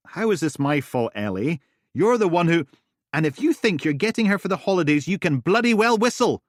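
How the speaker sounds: loud, high heated argument; angry retort. Acted. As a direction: fight back hard. Duncan arguing.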